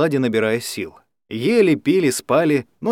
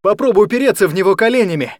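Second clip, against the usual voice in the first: fast urgent shout; 6 semitones higher, 4 dB louder, faster than usual. A narrator's reading. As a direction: shout the advice quickly. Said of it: "прокричал Алекс".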